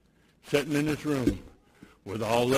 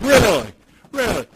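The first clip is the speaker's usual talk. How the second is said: mock surprise; 7 semitones higher, 9 dB louder than usual. Sponsor doubting the atheist claim.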